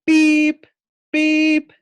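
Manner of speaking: very high beeping horn. Acted. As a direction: honk like a toy fire truck. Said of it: "honked the horn".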